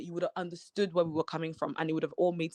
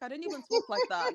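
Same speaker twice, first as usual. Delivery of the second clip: higher, rising disbelief; incredulous protest; 10 semitones higher than usual. Retold or imitated. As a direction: protest it as absurd, pitch up. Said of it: "I was like".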